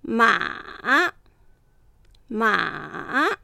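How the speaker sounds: ま kept low and held down; teacher demonstrating ま in low third tone; Mandarin third tone. Shown as a demonstration. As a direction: press ま low in the throat. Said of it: "低く抑える発音する".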